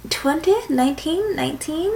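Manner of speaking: soft rising nineteen?, doubtful; questioning nineteen?, softly rising; unsure question. Real, not acted. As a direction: ask yourself nineteen? as if checking. Unsure of her age, checking.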